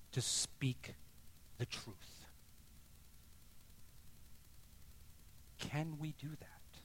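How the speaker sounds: whispered, soft challenge; breathy near-silent question; hushed whisper. Real, not acted. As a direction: drop to a whisper to draw them in. Preacher drops to hushed challenge.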